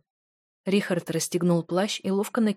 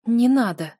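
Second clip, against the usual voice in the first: through gritted teeth; 5 semitones higher, 6 dB louder than usual. A narrator's reading. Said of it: "процедила сквозь зубы".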